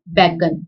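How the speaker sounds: बैगन with no nasal, plain ai; बैगन said bare, no n; without bindi. Shown as a demonstration. Teacher shows बैगन without bindi, no nasal. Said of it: "दोनों में फर्क पड़ रहा है".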